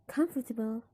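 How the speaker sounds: "comfortable" with the first syllable COM stressed. Shown as a demonstration. Teacher showing the suffix changes nothing, COM stressed in "comfortable". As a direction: punch COM at the start of "comfortable". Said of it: "the stress is still in the come".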